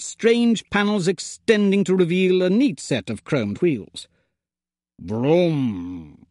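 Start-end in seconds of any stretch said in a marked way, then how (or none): 5.0-6.3 much lower, hesitant engine vroom; a tentative robot vroom; uncertain vroom. A narrator's reading. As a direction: try the engine noise without conviction. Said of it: "he said uncertainly".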